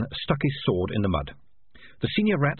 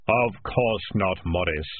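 slow, solemn Death voice; measured and grave, like Death; Death's voice; 3 semitones lower, much slower than usual. A narrator's reading. Death speaks.

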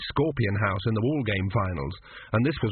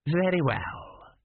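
cold hitman; 6 semitones higher, much slower than usual. A narrator's reading. Examiner concludes.

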